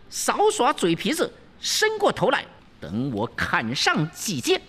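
high, fierce demoness daring him; high shrill threats from the sword-wielding princess; threatening princess. Acted. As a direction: threaten to hack at his head.

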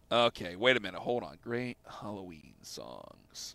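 very quiet, low, slow thinking aloud; muttering to himself. Real, not acted. Host pondering the Halloween songs topic, murmured to himself. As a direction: murmur it slowly as if jotting it down.

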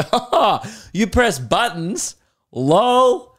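high-pitched mock amusement; mocking dismissal. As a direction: scoff with a fake laugh, high and drawn out. Mocks people's reaction to DJs.

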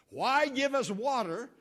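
loud, slow, aggrieved outburst; slow, loud, aggrieved cry; indignant complaint. Retold or imitated. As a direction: protest to God that the water is useless. People complain about bitter water.

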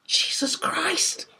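a breathless, drawn-out high gasp of shock; slow astonished exclamation; exclaimed. A narrator's reading. Thomas Clayson seeing the mermaid, breathless shock. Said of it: "exclaimed Thomas Clayson".

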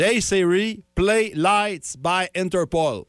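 slow, carefully spelled-out English command; talking to Siri. Retold or imitated. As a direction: say it very slowly so the phone understands. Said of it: "puis là, je dis".

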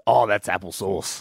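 low, slow, drawn-out quip; mock silly voice. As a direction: drop the voice and drag it out. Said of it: "You wanted to refer to things that were good happening as".

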